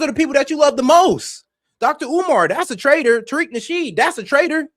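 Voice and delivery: building, high-pitched outrage; heated rant. Real, not acted. Streamer to chat, building to a furious peak. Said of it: "let me calm down".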